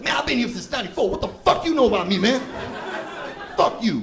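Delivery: high, loud, indignant street rant; angry homeless man. Retold or imitated. Homeless man snapping at the comic, high and loud. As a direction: rant back, offended and loud. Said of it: "They're like".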